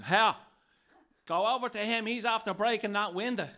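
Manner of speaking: high, loud shout across the street; urgent calling out. Retold or imitated. Passers-by summoning men in cop uniforms, urgent shout. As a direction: yell it like an alarmed bystander. Bystanders call to supposed cops.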